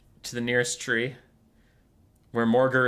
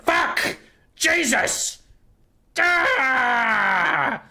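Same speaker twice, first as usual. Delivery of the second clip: high, loud screaming curses; curse, then scream it out; frustrated outburst; 13 semitones higher, 5 dB louder, much slower than usual. Acted. Skrulk after breaking his neck again, screaming frustration.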